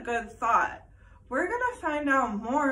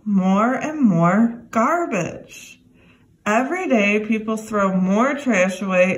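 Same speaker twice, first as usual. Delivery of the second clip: louder, clear read-aloud for a class; reading to children; 2 semitones lower, 13 dB louder than usual. Acted. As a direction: read the book to the kids, steady and clear. Teacher reads book to class.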